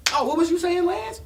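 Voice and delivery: high, bold fake-sweet voice; mocking girlfriend. To Lance as her man walks out, mocking fake-sweet. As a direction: mimic her taunting innocence.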